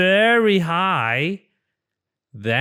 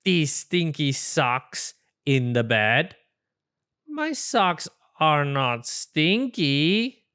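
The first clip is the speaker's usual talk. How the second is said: ordinary delivery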